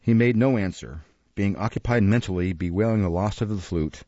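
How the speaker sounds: usual speaking voice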